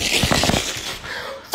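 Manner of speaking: loud airy laugh; laughing at his own bit; breathless shouted laugh. Real, not acted. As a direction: burst out laughing.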